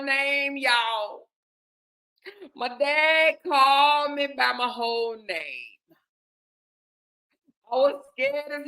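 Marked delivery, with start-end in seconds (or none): 2.6-6.0 slow, drawn-out dread; dramatic emphasis. Real, not acted. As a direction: stretch every word for the audience. Repeats the ominous moment slowly.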